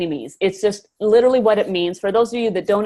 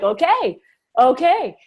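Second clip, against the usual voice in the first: excited okay; 6 semitones higher, 4 dB louder, slower than usual. Retold or imitated. Retold own reaction, "I'm like.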